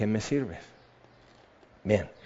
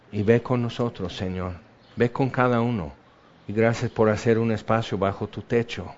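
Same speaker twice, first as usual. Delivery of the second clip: heartfelt prayer; 3 semitones lower than usual. Acted. Pastor praying with the standing congregation, hushed and grateful.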